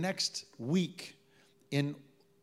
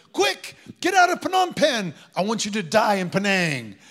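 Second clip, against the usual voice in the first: much higher and louder hammed-up shout; mock urgent command; 7 semitones higher, 12 dB louder than usual. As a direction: parody an absurd God yelling orders. Mock voice of God, absurd.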